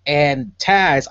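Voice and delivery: normal talking voice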